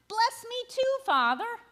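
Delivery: high, pleading cry of bless me too; desperate begging. A narrator's reading. Esau begs his father a second time, high pleading. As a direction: beg harder, voice climbing high. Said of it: "Esau begs".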